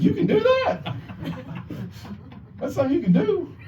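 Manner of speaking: high incredulous laughing question; amazed disbelief. Retold or imitated. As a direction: ask in gleeful disbelief. Kid amazed at dad.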